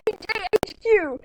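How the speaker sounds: normal talking voice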